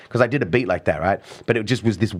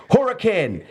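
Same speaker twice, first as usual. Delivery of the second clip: mc introducing himself; 12 semitones higher, slower than usual. Retold or imitated. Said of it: "He's like".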